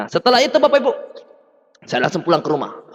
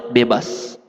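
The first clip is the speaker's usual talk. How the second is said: low casual reply; 12 semitones lower, 4 dB quieter than usual. Retold or imitated.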